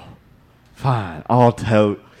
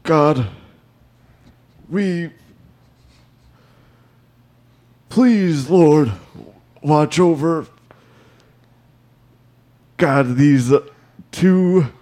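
higher, halting mock prayer with long pauses; deacon's prayer; 4 semitones higher than usual. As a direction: pray haltingly over the couple. Deacon leads a wedding prayer.

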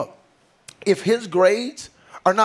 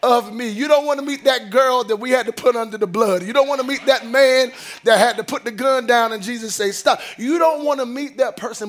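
rising, sung preacher's cadence over the organ; preaching build; 5 semitones higher, 3 dB louder than usual. Acted. Pastor warning the congregation in a swelling sung preach. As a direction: preach it higher and louder, line after line.

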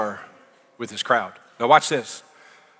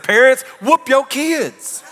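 shouted high and slow, dragging it out; preacher's shout; 10 semitones higher, 4 dB louder, slower than usual. Acted. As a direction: holler it at the parents. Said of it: "preacher's punchline".

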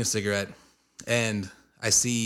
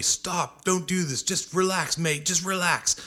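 higher-pitched pleading mock bouncer; imitated bouncer; 7 semitones higher, slower than usual. Bouncer telling him to stop the fight, imitated urging. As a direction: act out a bouncer trying to calm someone down. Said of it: "this bouncer's telling me".

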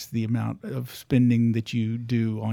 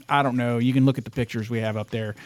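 ordinary delivery

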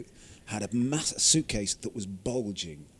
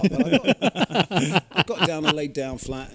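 higher, louder, laughing while telling; laughing storyteller; 5 semitones higher, 9 dB louder, slower than usual. Real, not acted. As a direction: laugh through the confession.

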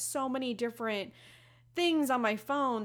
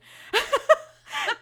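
high, loud burst of laughter; 13 dB louder than usual. Real, not acted. Laughs about her messy house.

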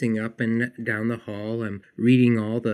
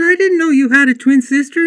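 amazed; 9 dB louder, faster than usual. Retold or imitated. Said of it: "I said".

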